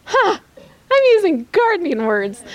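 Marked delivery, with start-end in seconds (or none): 0.0-0.4 emotional gasp. Real, not acted. Emotional break mid-sermon.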